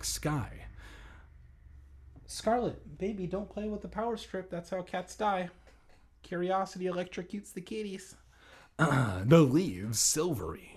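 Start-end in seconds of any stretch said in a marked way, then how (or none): 2.3-8.2 higher, soft pet-scolding voice; talking to a cat. Real, not acted. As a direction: gently scold the kitty.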